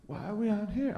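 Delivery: high, soft nervous rooster voice; desperate rooster. Retold or imitated. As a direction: sound like a rooster realizing the danger. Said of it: "He's like".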